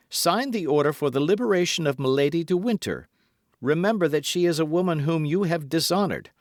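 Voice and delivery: high, loud, pressing appeal; urgent demand. A narrator's reading. Felton urges the Duke to free Milady, urgent demand. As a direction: press him earnestly to comply.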